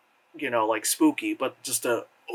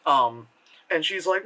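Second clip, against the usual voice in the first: normal talking voice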